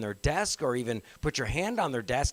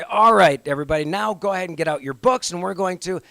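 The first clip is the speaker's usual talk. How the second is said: loud, higher call to the class; teacher voice; 3 semitones higher, 7 dB louder than usual. Shown as a demonstration. Teacher moving a class to the next activity, loud teacher voice. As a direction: project over the chatter to get attention. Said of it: "the teacher voice".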